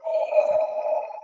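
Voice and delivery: very high breathy gasp of shock; a whispered squeak of disbelief; hammed-up breathless oh; mock gasp. Mocking own shocked reaction.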